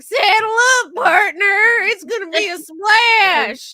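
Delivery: high, loud, hammed-up Texas ride announcer, slow at the end; water park ride voice. Host imitating a toboggan ride recording, hammed-up Texas accent. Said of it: "launch into the recording that's on".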